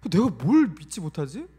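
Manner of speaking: usual speaking voice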